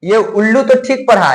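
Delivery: ordinary talk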